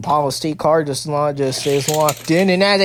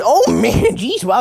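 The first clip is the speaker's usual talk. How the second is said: higher, louder burst of surprise; startled exclamation; 7 semitones higher, 4 dB louder, slower than usual. Real, not acted. Race commentator reacting to a sudden launch, startled. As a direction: blurt it out as the hit lands.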